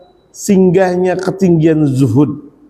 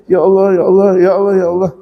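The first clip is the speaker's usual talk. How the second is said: retold crying prayer; faster than usual. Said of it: "Nangis saya".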